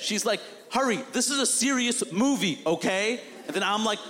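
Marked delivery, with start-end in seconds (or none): 0.7-3.2 higher-pitched mild mock scolding; pissed director. Director tells him this is a serious movie, pissed. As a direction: imitate an exasperated director, pitched up. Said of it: "the director finally got pissed".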